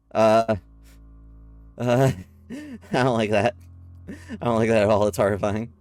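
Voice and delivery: loud, nervous, spooked reaction; nervous scared laugh. Real, not acted. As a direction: laugh it off, clearly rattled. Spooked by the creepy scene.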